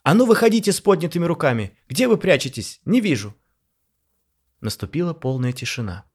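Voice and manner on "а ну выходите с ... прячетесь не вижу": loud, higher commanding yell; nervous guard yelling demands; shouting. A narrator's reading. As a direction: order them out at full voice. Guard shouting at hidden voices.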